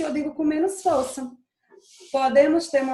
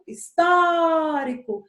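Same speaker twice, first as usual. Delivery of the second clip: "histórico" with a long, loud "tó"; stretched stressed syllable; 4 semitones higher, 4 dB louder, much slower than usual. Shown as a demonstration. Teacher giving another example, "tó" of "histórico" drawn out. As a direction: swell and hold the "tó" in "histórico". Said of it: "Onde foi que eu passei mais tempo".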